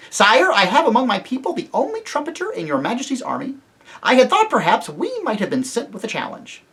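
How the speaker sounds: high, shrill mouse with courtly formality; high petition, easing down at phrase ends; Reepicheep. A narrator's reading. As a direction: plead your honor proudly in a piping voice. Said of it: "came a shrill voice".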